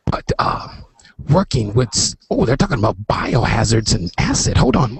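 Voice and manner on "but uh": unvoiced hushed whisper; whispering on the phone. Real, not acted. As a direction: whisper so the live show can't hear. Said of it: "I have to whisper".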